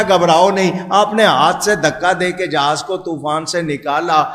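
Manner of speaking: usual speaking voice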